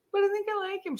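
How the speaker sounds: put-on high, pleading teen voice; mock whiny daughter begging mom; whiny plea. Daughter protesting to mom, high and whiny.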